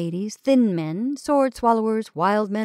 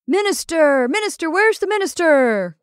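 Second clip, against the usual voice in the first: higher, louder call across the hall; crying out; 8 semitones higher, 6 dB louder than usual. Acted. Revellers calling for a minister, shouting. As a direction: shout for the minister over the party. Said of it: "cried voices out of the revel".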